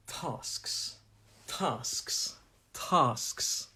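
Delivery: TASKS said slowly with a long southern "ah"; southern English pronunciation. Shown as a demonstration. Teacher models a word for learners, southern TASKS with long "ah". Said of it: "my pronunciation is quite southern".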